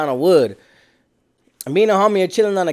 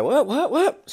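high, laughing disbelief, softer; suddenly sobered up, much higher; stunned what; 8 semitones higher, 4 dB quieter, slower than usual. Retold or imitated. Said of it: "me and my Cody were like".

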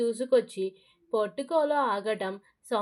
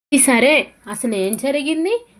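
insistent loud demand; 8 dB louder than usual. A narrator's reading. Mother demands answer from son.

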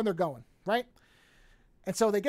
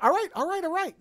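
scared computer; 9 semitones higher, 3 dB louder than usual. Retold or imitated. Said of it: "I guess, is scared".

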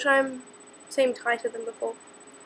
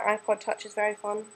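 ordinary talk

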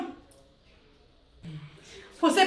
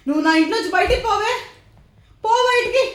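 angry yell; 4 semitones higher, 4 dB louder, faster than usual. Acted. Throwing intruder out.

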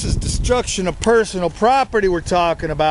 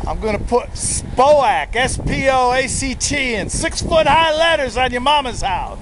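loud, higher taunting rant; mock tough threat; 6 semitones higher, 3 dB louder than usual. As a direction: jeer at them like a street tough.

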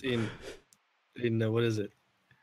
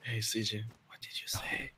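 hushed, voiceless whisper; 5 semitones lower, 11 dB quieter than usual. Pretend. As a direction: whisper it as if close to someone's ear. Whispered role-play to character CJ.

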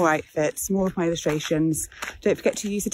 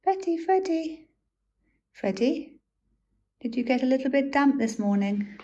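high sing-song pet voice; 6 semitones higher than usual. Real, not acted. Cooing to her wet dogs.

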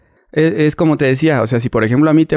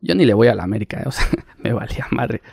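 normal talking voice

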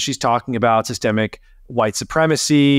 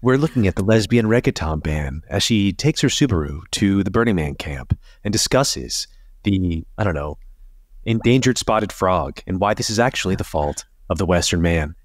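breathy NPR voice; 4 semitones lower than usual. Retold or imitated. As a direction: parody a hushed public radio host. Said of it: "They say it in that breathy voice".

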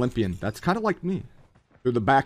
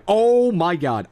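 surprised; 5 semitones higher, 7 dB louder, slower than usual. Real, not acted. Gamer reacts in game.

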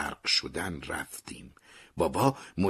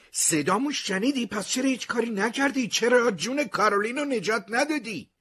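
much higher, loud anguished shout; 5 dB louder than usual. A narrator's reading. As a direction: shout it at him in disbelief. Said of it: "داد زدم".

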